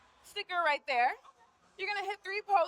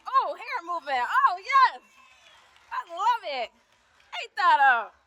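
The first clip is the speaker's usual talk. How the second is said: excited cheering; 3 semitones higher, 6 dB louder than usual. Real, not acted. Cheering a model's poses.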